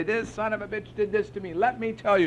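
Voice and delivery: high-pitched, indignant venting; worked-up complaint. Retold or imitated. Sponsee phoning sponsor to complain, worked up. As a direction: imitate a worked-up caller with a grievance. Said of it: "I would call him and I'd say".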